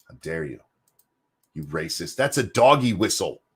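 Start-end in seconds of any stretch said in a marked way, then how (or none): 0.1-2.2 much softer, lower mock-shocked murmur; quiet mock indignation; mock hushed. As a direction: drop to a scandalised hush. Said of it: "they'd be like".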